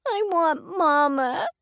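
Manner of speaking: put-on high, slow, crying little girl; sobbing again. A narrator's reading. Little girl sobbing for her mother again, high and crying. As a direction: wail it again through tears. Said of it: "Addie sobbed again".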